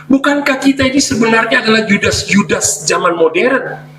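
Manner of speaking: high, pressing preacher voice; rising sermon challenge; fervent preaching. Real, not acted. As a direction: drive the rebuke home to the congregation.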